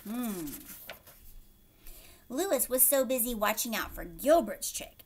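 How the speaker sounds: usual speaking voice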